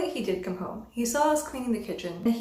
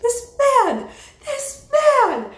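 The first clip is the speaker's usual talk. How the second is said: high, loud furious scream of "This man!"; outraged yelling; 14 semitones higher, 10 dB louder, much slower than usual. Retold or imitated. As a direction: shriek "This man!" at the top of the voice, livid. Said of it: "I was screaming outside".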